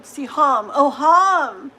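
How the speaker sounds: See, hum said as two loud parts, hum rhyming with mom; name sounding out. Shown as a demonstration. Streamer sounding out a viewer's name, loud and deliberate. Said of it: "Like mom".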